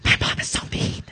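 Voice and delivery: whispered fake crying. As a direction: whimper it in a breathy whisper. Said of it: "the whisper cry".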